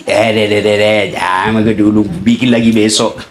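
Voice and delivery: low sly devil voice; satan whispering. Acted. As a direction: coax like the devil in your ear. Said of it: "setan bisik ki".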